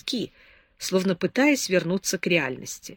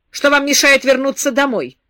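sharp demand, higher and louder; 7 semitones higher, 8 dB louder than usual. A narrator's reading. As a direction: snap the question at her. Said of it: "резко спросил он". (резко, требовательно)

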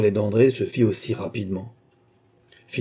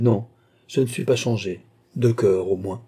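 usual speaking voice

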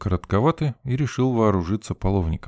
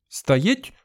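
sharp command; 11 semitones higher than usual. A narrator's reading. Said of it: "раздалось за спиной".

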